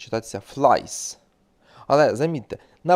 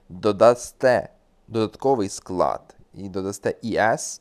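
ordinary talk, nothing marked

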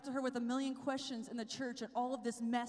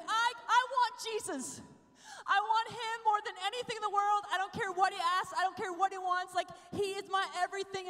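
higher and louder, crying out with longing; imitating sobbing; 6 semitones higher, 5 dB louder than usual. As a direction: retell her tearful outburst, voice straining. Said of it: "burst into my room sobbing".

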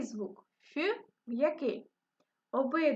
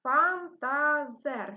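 slow syllables; 4 dB louder, much slower than usual. Shown as a demonstration. Teacher slowly splits Фантазер for children, stretched out. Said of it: "Які знаки в нас будуть".

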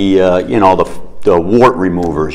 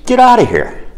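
high-pitched exasperated shout; 14 semitones higher than usual. Acted. Pharmacist throwing the duck out, high-pitched exasperated yell. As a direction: pitch jumps way up on an outburst.

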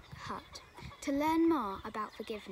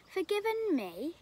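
high, raised disbelieving cry; incredulous cry; 8 semitones higher, 4 dB louder than usual. Acted. Robber stunned that the monk forgave him, crying out. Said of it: "the robber cried".

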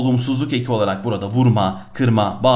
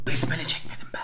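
hushed confession under the breath; whispered aside; 7 dB quieter than usual. Real, not acted. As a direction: whisper it to yourself like a guilty secret. Confesses forgetting prayer, aside.

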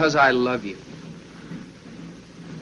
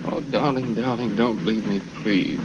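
soft and lower, breaking; tearful plea; 7 semitones lower, 6 dB quieter than usual. Acted. Man softly begging his dying lover to stay. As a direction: beg her quietly not to die.

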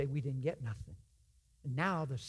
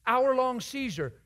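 Hour-long seizure, slow and much louder; emphatic repeat; 8 semitones higher, 7 dB louder, slower than usual. Shown as a demonstration. Preacher stressing to nurses how long the seizure was, louder.

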